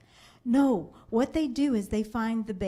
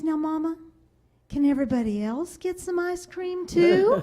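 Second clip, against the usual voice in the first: put-on higher, sweetly scheming little girl; imitating sly child; 7 semitones higher, 4 dB louder than usual. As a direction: push your luck with a sweet child voice. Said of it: "And she goes".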